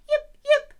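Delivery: sharp, very high loud yips; fox yip. Shown as a demonstration. Teacher answers the song as a fox, fox yip. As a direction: yelp like an excited fox. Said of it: "a fox makes a sound like this".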